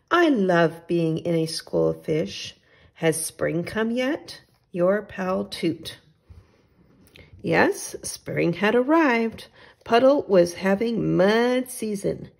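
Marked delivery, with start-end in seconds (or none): none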